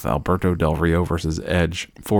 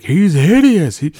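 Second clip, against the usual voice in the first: high, loud, hammed-up disgust; mock announcer horror; 10 semitones higher, 7 dB louder than usual. Announcers reacting to Kane unmasked, hammed-up shock. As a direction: shriek it like a horrified announcer. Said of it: "they're like".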